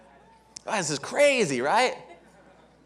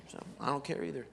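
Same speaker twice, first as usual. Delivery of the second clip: very soft, low mock indifference; uninterested; 4 semitones lower, 13 dB quieter, faster than usual. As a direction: mumble it as if you've checked out. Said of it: "acting like they're uninterested".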